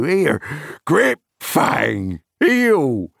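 high, loud call to heel; cried command. A narrator's reading. Farmer ordering his dogs to heel, loud cry. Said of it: "he cried".

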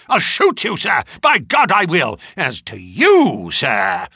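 put-on loud, raging old colonel; furious shout. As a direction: bellow the threat at the intruders. Furious old colonel ordering them out.

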